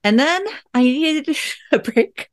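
laughing through it; a tired mom laughing at herself; wry laugh. Real, not acted. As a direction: laugh at how spent you were. Laughing at her exhaustion.

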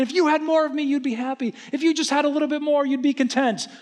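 very high, put-on talking-wallet voice; comic high-pitched voice of fretful money. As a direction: squeak like a nagging, worrying wallet. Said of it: "Like, money is talking".

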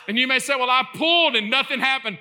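impassioned high shout to the congregation; full-force preaching. Real, not acted. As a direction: drive it home like a fired-up preacher.

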